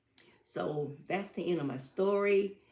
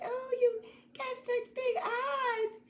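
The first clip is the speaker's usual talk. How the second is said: put-on very high, slow wondering girl voice; little girl voice; slower than usual. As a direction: marvel slowly like a puzzled child. Girl to disguised wolf.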